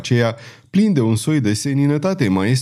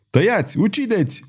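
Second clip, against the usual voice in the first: high, urgent guards' cry; howled orders; 9 semitones higher than usual. A narrator's reading. Guards closing in on the fugitive, howling. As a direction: yell it high, at a narrator's volume. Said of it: "urlau străjile".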